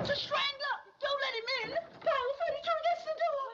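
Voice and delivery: high-pitched frantic cries; panicked alarm. Acted. Woman rousing others against a strangler, panicked. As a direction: shriek for help to hold the door.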